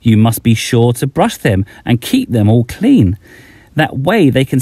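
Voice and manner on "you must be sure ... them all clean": ordinary delivery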